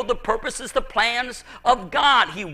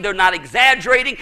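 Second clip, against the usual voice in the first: normal talking voice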